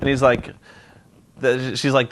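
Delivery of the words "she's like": ordinary talk, nothing marked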